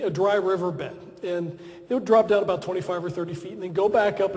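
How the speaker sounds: usual speaking voice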